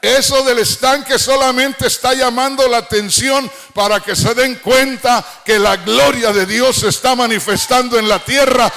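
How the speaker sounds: fervent, ringing sermon climax; full-force preaching. Real, not acted. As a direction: proclaim it to the crowd in one driving run.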